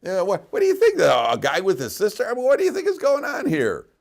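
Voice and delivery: high, loud, incredulous challenge; incredulous congregant. Retold or imitated. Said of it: "That's exactly what he said".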